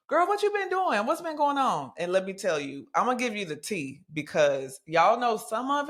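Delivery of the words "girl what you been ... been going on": put-on high-pitched, playful girlfriend voice; sassy self-question. Podcaster quizzing herself like a gossiping friend, high and sassy. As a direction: ask it high like a nosy bestie. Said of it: "I actually took a second to write down".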